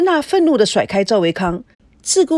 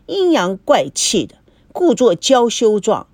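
usual speaking voice

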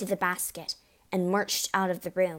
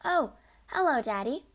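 put-on higher, sweet little girl's hello; pleasant greeting; 6 semitones higher than usual. A narrator's reading. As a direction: greet him brightly, innocently. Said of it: "she said pleasantly".

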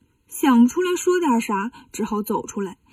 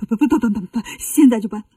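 rapid-fire stuttering panic, hammed up; terrified stammer; 2 semitones lower than usual. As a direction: stutter frantically, then blurt out your surrender. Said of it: "两个和尚看见都吓坏了". (吓得连连结巴地答应)

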